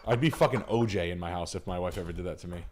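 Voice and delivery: usual speaking voice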